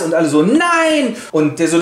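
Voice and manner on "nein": high drawn-out group no; crowd protest. Acted. The crowd protests against Gessler, high drawn-out no. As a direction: wail the no up high.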